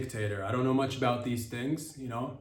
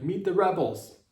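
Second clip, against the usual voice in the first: mock announcement; 8 semitones higher, 7 dB louder than usual. Announcing the rebels.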